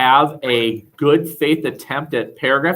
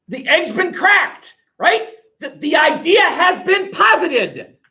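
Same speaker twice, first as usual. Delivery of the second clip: dramatic questioning; 10 semitones higher than usual. Real, not acted. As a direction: pose the big question with drama.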